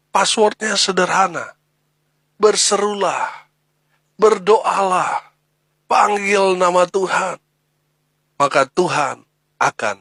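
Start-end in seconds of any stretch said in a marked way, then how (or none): 2.4-7.4 slow, high, stressing each command; slow preacher's emphasis; emphatic slow. Acted. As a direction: drive home each word like a password. The password, spelled out emphatically.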